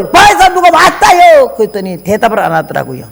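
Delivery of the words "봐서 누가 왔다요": higher, louder and slow call; drawn-out call to the house. Retold or imitated. Calling husband about visitor. (높고 크게 늘여 부르기)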